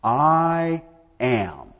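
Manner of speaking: "I am" drawn out with solemn force; profoundly emphatic. Shown as a demonstration. Preacher showing how emphatic God's name is, emphatic "I am". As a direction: stretch "I" and land firmly on "am". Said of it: "Profoundly emphatic".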